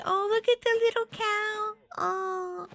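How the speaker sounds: high, delighted coo; cooing at cute cow. Real, not acted. Streamer spotting a little cow in the game, delighted high coo.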